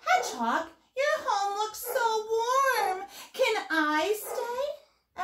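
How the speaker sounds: high, very drawn-out pleading; eager little owl. A narrator's reading. Little Owl admires Hedgehog's warm home, high, slow and hopeful.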